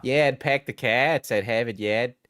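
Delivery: the classic dropped-r Boston accent; thick Boston accent. Shown as a demonstration. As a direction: quote the old Harvard Yard line in a thick Boston accent. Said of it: "That really thick Bostonian accent".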